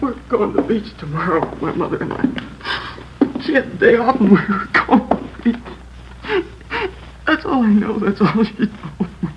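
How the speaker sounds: high, breaking voice on the edge of tears; crying boy. Acted. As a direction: let the words crack as he sobs. Boy breaks down after mother's murder.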